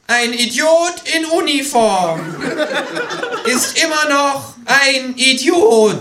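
high, slow, drawn-out yell; defiant high-pitched bellow; screaming kangaroo. A narrator's reading. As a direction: shout it high, stretching each word. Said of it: "Das Känguru schreit".

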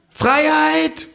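sehr hoher, gedehnter Ausruf; rufendes Känguru. Acted. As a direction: pathetisch in die Kneipe rufen. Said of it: "ruft das Känguru". (hoher Känguru-Ruf)

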